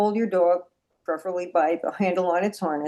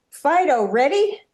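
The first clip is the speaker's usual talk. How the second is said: louder, higher chirpy "Fido, ready?"; mock handler cue; 7 semitones higher, 8 dB louder than usual. Shown as a demonstration. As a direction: call the dog's name brightly with a rising "ready?". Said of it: "You're not going to say".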